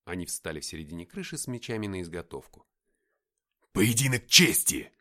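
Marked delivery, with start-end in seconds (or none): 3.7-4.9 slow, loud bellow; roaring demand. A narrator's reading. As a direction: roar out a challenge to a duel. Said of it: "рявкнул Деймос".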